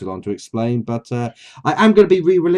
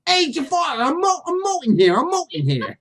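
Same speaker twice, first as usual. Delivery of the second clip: high squawky parrot sidekick; whiny cartoon parrot complaining; Iago voice; 13 semitones higher than usual. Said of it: "Iago sounds really".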